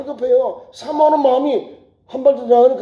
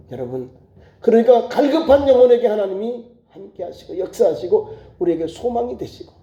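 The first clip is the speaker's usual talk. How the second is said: ordinary talk, nothing marked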